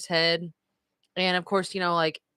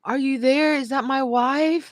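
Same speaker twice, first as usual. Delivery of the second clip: high, searching question; groggy husband; 6 semitones higher than usual. Retold or imitated. Husband asks if his wife is there, groggy and high. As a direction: imitate a woozy patient calling out, pitched up. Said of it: "He's like".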